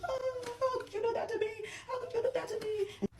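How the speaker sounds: high, mocking whiny imitation of her crying; hammed-up fake sobbing plea; mock crying whine. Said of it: "And then she starts crying".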